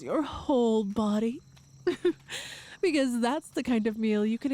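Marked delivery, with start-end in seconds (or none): 1.9-2.8 breathy laugh. Acted. Boasting about huge meal.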